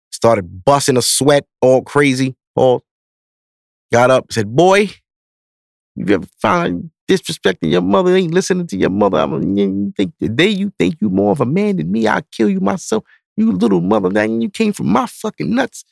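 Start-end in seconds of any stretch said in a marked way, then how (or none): 4.5-15.8 high-pitched, worked-up dad rant without extra volume; imitated scolding father. Dad berating son after choking him, imitated scolding. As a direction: rattle off a dad's outraged tirade in a raised pitch.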